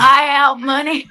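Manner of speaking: slow drawled "Buyout money" in the impression voice; Sam Pittman impression. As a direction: repeat the line on request, drawn out. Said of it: "Do that again".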